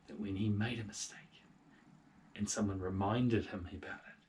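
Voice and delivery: ordinary delivery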